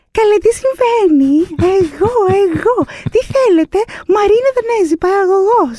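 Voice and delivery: high, squeaky mock-innocent voice; high sing-song feigned innocence, calling out to armed police; playing the innocent. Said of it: "αρχίζω και φωνάζω".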